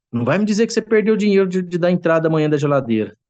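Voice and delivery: high, fast alarmed accusation; a wife snapping at her husband in alarm; mock alarmed wife. Wife alarmed at husband over lost money, high and fast.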